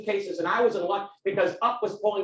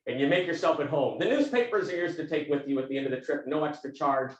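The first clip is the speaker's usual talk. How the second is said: low Texan cab driver impression; deep Texan voice; 6 semitones lower than usual. Cab driver settling a passenger in, in a low Texan voice. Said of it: "I won't get as low as he can go".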